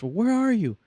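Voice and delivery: high, loud screeching rant; mock furious caller. Caller furious there is no manager, loud screeching. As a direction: blow up at the agent.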